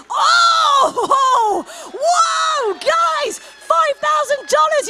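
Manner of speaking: high and loud, thrilled announcement; excited shout. Real, not acted. Auctioneer announcing a five thousand dollar bid to the guests, excited shout. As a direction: shout the bid out to the whole room.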